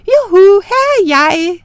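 put-on high, loud teasing call of a hiding girl. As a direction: yell it out to taunt the moon man. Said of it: "og råbte".

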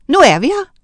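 high, loud announcing call. A narrator's reading. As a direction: call it out on arrival. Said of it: "råbte hun".

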